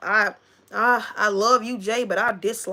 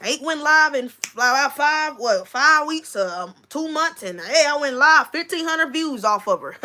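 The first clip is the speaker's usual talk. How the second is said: mock gloating; 6 semitones higher than usual. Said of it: "he's over like".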